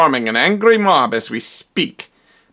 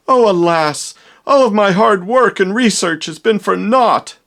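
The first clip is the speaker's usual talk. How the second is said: theatrical lament; 7 semitones higher, 3 dB louder than usual. Acted. Scientist laments failed experiment.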